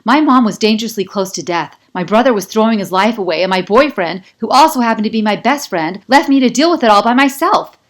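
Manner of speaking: hurt, furious tirade; reckless tirade. A narrator's reading. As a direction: let it all out at once. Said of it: "all commotion and reckless speed".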